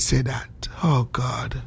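low, softly whispered dismay; flustered whisper. Real, not acted. He realises he can't say that, whispering in dismay. Catches himself saying something improper.

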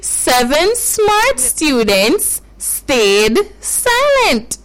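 very slow, separated words; careful demonstration. Shown as a demonstration. Teacher gives the child another try, very slow model. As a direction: take it word by word so a child can follow. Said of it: "let's go again".

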